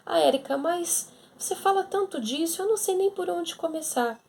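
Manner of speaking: voz bem mais aguda, ouvinte reclamando; faça a ouvinte que se queixa, em tom agudo; queixosa imitada. Retold or imitated. Ouvinte imaginária reclamando com Érica. (ouvinte imitada reclamando, voz mais aguda)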